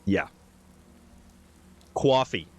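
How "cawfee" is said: New York "cawfee", rounded first vowel; New York accent. Shown as a demonstration. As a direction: say it like a New Yorker, "caw-fee". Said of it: "definitely a New York thing".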